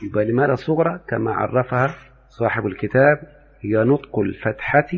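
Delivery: normal talking voice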